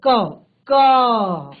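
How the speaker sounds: क repeated, ending on a clear "a"; sound demo. Shown as a demonstration. Teacher repeats क to show the vowel. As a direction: repeat क twice, stressing the a. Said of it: "अ का स्वर आ रहा है".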